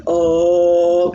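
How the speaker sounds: low-pitched demo oh. Shown as a demonstration. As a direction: drop down to a low oh. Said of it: "trying to get lower tones".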